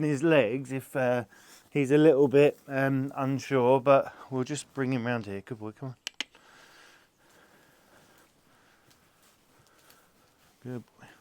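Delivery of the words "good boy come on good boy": hushed, near-whispered reassurance; soothing the horse. Real, not acted. Trainer calming a horse that kicks, hushed reassurance. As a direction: settle him down softly.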